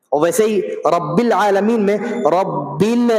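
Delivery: ordinary delivery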